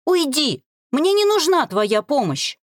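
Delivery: high, heated outburst; angry rejection. A narrator's reading. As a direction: drive them away angrily. Furious rejection of betrayer's help.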